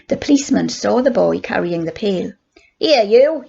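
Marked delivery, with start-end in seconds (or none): 2.8-3.4 put-on loud call to the boy; policeman's yell. A narrator's reading. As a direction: yell at him to get away. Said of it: "he yelled".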